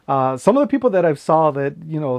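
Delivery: ordinary talk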